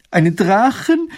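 high, slow, terrified question; horrified cry. A narrator's reading. Mathilde shrieks in horror at dragon news. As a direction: shriek it out in fright. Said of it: "schreit Mathilde entsetzt auf".

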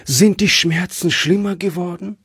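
a touch of alarm, voice lifted to the end; anxious. A narrator's reading. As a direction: ask fearfully after his pain. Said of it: "fragte sie ängstlich".

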